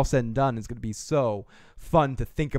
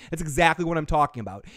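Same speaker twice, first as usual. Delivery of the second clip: usual speaking voice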